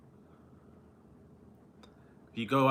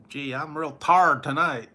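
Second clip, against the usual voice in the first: slow, louder mock Southern drawl; tired said as tard, hammed-up country; a slow mock Southern country accent; 4 semitones higher, 8 dB louder, slower than usual.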